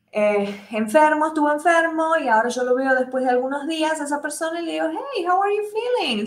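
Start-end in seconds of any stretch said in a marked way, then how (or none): 4.9-6.3 high, caring question to a friend; teacher acting a friend checking on someone; concerned greeting. Shown as a demonstration. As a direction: ask a sick friend how he is.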